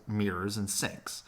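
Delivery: usual speaking voice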